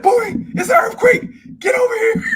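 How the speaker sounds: high, loud, slowed panicked mom yell; mock mom summoning her boy in alarm; mom calling out. Mom rushing in calling her son in a high mock yell. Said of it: "She said".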